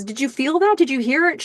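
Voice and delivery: high-pitched, eager retold questions; excited. Karen checking that Graham felt it too, excited. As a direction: act out her thrilled questioning. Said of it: "She's so excited".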